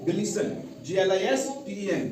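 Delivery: ordinary delivery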